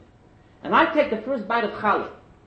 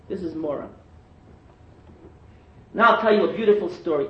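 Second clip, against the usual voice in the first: normal talking voice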